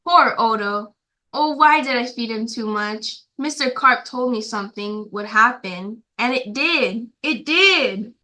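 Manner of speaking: plain reading voice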